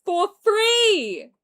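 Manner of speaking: loud, shouting, frustrated yelling; furious rant. Real, not acted. Streamer yelling at the fans who got him cancelled, furious. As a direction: rant hard at the people who ruined it.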